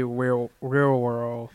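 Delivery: real and world run together, slow and effortful; "real world" said slowly, the L sounds blurred; struggling with real world. Shown as a demonstration. A speaker who can't say real world, stumbling. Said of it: "I cannot for the life of me say real and world together".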